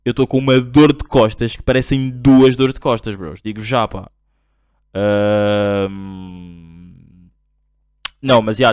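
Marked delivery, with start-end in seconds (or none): none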